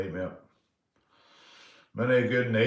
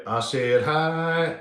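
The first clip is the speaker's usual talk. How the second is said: ACID HIGH stretched out on a raised pitch; high and drawn-out; 4 semitones higher, much slower than usual. Shown as a demonstration. Drawn-out high versus low.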